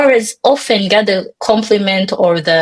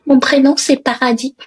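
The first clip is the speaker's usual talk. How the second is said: said in French, with a French accent and a higher pitch; 6 semitones higher, faster than usual. Shown as a demonstration. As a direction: introduce yourself the French way. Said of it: "with a French accent".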